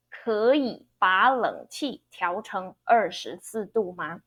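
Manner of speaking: 可以把冷气 with 可 second, 以 third, 把 second, 冷 third; second third second third tones. Shown as a demonstration. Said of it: "One is second, third, second, third".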